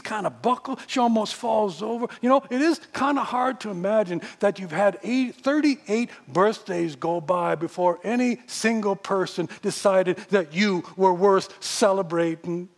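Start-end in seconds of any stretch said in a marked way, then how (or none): none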